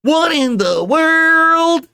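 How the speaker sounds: high, loud, drawn-out disbelief; incredulous outburst. Acted. Reacting to attack on his show, incredulous outburst. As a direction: stretch the last word high and long.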